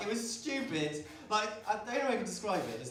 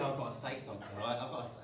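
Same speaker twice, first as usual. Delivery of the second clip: inner thought; 11 semitones lower, 8 dB quieter, faster than usual. Retold or imitated. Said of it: "he's thinking".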